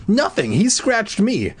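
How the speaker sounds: put-on high, drawn-out kid's whine; whiny protest. A narrator's reading. Son defends himself to scolding mom, whiny. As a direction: protest your innocence, pitched high.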